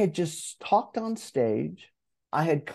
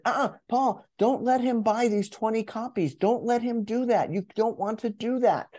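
higher, nagging inner critic warning him off; an anxious inner voice pleading, higher; imposter voice; 7 semitones higher than usual. Retold or imitated. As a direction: play the fretful imposter, pitched well up. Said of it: "My imposter voice still said".

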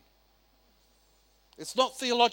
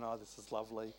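quiet, lower, mock-serene irony; sarcastic pastor pretending all is fine; mock calm; 11 semitones lower, 16 dB quieter, faster than usual. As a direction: play it falsely pleased and unbothered. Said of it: "Pastor Josh is just going".

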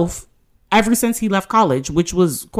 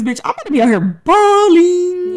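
excited; 11 semitones higher than usual. Real, not acted. Excited about future money.

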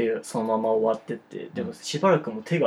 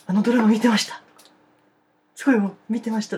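high, loud eager fan greeting; excited fan; 9 semitones higher, 5 dB louder, faster than usual. Retold or imitated. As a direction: gush like a nervous fan. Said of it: "とか".

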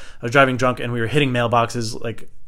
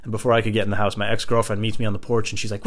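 ordinary talk, nothing marked

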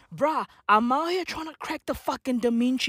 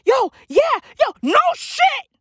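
mock excited; 11 semitones higher, 4 dB louder than usual.

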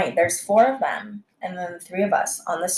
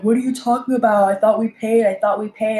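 playing dumb; 3 semitones higher, 3 dB louder than usual. Drunk customer caught in the parking lot, playing dumb. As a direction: imitate someone acting clueless, voice pitched up. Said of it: "she tries to act stupid".

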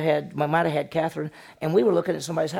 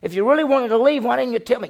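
loud, higher, indignant outburst; acted fury, louder and higher; angry Laban; 8 semitones higher, 7 dB louder, faster than usual. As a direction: let the hurt father rage at the runaway. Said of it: "You can see him getting mad".

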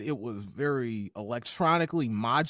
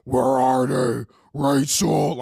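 louder, slow raspy mock Batman; imitating Batman growl; 3 semitones lower, 8 dB louder, slower than usual. Host mocking a Batman voice, loud slow rasp. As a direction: bark it slowly like a smoker Batman. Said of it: "he's talking like this".

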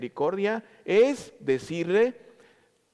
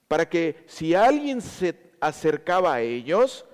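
ordinary talk

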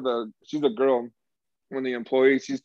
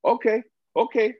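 mock exasperated okay; 10 semitones higher, 3 dB louder than usual. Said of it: "He's like".

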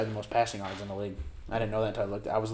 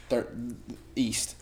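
normal talking voice

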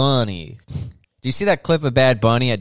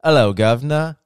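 put-on cheery British accent, a bit louder; 5 semitones higher, 8 dB louder than usual. Dad answering the phone, imitating a British accent. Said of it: "talks with a British accent when he answers the phone".

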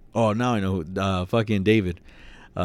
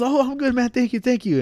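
a much higher, polite brush-off reply; mimicking David; 3 dB louder than usual. David politely answering passing worker, high mock-sweet voice. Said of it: "And then David was like".

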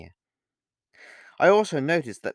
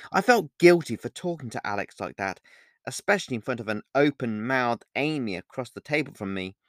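ordinary delivery

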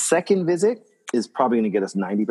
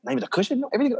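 mock client, higher and softer; gushing client; 12 semitones higher, 4 dB quieter, much faster than usual. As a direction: play the delighted client, up high and soft. Client voiced in retold story.

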